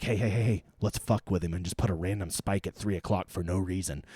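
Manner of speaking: low, soft conspiratorial voice of the plotters; scheming forecasters. Retold or imitated. Weather site staff plotting a prank, low and hushed. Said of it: "they're like".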